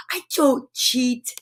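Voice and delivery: slow whispered aside; playful hushed denial. Real, not acted.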